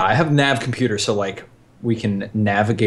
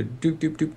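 soft imitated button beeps; beeping buttons; 6 semitones higher, 7 dB quieter than usual. Player mimicking pressing keys on the compad, soft beeps. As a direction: tap out beeps with the mouth. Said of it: "I pull up my compad".

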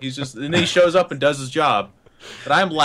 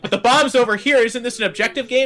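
high-pitched, exasperated objection; acted protest; 11 semitones higher than usual. Player arguing with child shot-caller, high protesting voice. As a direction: plead the obvious to a kid. Acted argument with kid teammate.

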